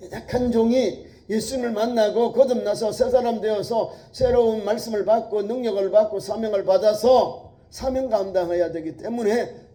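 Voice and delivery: loud, high preaching; preaching at full force. Acted. Preacher lifting the congregation, loud and high. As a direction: build the promise up.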